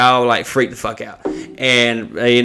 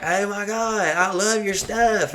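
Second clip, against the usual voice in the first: high, soft, drawn-out fangirl imitation; mock gushing fan; 8 semitones higher, 6 dB quieter than usual. Retold fan gushing at him.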